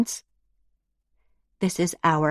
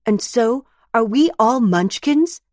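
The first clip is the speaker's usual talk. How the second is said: indignant; 7 semitones higher, 5 dB louder than usual. Acted. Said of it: "asks Juno indignantly".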